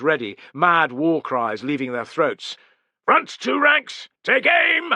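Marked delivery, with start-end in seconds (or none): 3.1-4.9 yelled order. Acted. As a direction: yell it so every man hears. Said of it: "he yelled".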